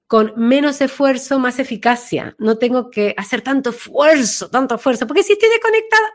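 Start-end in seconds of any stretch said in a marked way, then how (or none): 5.1-6.1 aguda y rápida, sin centro; voz aguda desconectada. Shown as a demonstration. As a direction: chilla apurada como desconectada. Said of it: "la voz ahí metida". (voz aguda y acelerada de desconexión)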